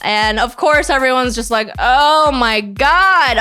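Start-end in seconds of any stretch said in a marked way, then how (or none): 1.8-3.4 very slow, drawn-out eye-roll "oh my God"; slow, exasperated mock drawl; mock exasperated groan. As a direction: drag it out, fed up. All the guys groan.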